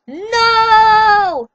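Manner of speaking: high, loud scream. A narrator's reading. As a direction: cry it out in terror. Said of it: "I screamed".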